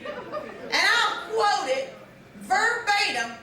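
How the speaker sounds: ordinary talk, nothing marked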